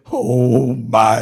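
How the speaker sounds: usual speaking voice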